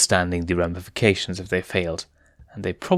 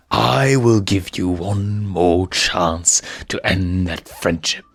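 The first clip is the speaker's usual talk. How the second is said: exasperated villain; slower than usual. A narrator's reading. Villain berates hyenas.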